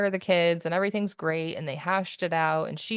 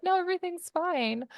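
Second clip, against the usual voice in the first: much higher, breezy "No, everything's fine."; fake cheerful reassurance; 13 semitones higher, faster than usual. Calling her mom to hide the chaos: falsely bright reassurance. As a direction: chirp it up high so mom won't worry.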